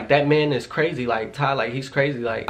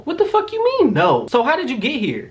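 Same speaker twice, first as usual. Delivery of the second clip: much higher, incredulous demand; outraged disbelief; 13 semitones higher than usual. Retold or imitated. Shouting disbelief at the ex who followed her. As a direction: fire it back, high and baffled. Confronting ex at gas station.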